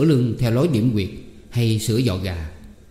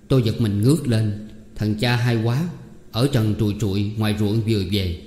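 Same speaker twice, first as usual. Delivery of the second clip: plain reading voice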